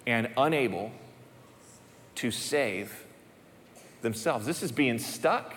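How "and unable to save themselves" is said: lower, softer, drawn out with pauses; heavy sermon emphasis with long pauses; weighty, slow emphasis. Shown as a demonstration. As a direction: let each word sink in. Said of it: "Let that sink in".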